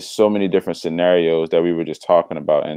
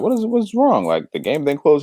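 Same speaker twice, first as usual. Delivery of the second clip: concerned mom; 9 semitones higher than usual. Retold or imitated. Mom asking son what's wrong.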